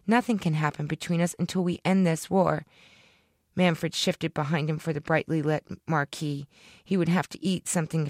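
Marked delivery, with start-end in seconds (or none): none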